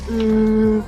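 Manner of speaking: normal talking voice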